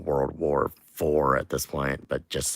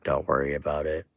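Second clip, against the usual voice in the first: normal talking voice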